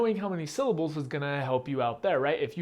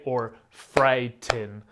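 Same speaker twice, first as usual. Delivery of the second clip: frighten slowly, louder, beat by beat; slow syllable split; 5 dB louder, much slower than usual. Shown as a demonstration. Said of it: "you clap for each sound".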